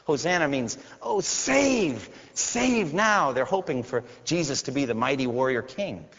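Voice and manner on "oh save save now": high, lifted plea; pleading cry. Retold or imitated. Preacher echoing the crowd's cry, high and pleading. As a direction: lift the words up like a child's cry. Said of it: "They're crying out".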